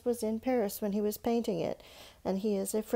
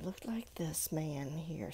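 whisper; 6 semitones lower, 12 dB quieter than usual. Real, not acted. Teacher whispering to children while showing the picture. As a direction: lean in and whisper it.

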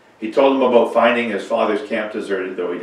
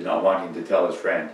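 plain reading voice

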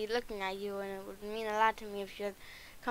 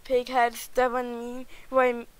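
louder, pointed insults, slowed down; heated; 6 dB louder than usual. Acted. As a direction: throw the insults at him one by one. Heated argument with Shrek.

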